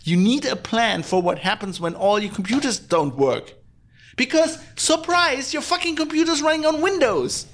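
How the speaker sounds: high-pitched, incredulous rant; exasperated rant. Real, not acted. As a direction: drive it home in a high, indignant voice. Exasperated rant about missing backup plans.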